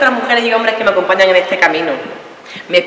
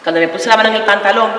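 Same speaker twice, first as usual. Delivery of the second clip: normal talking voice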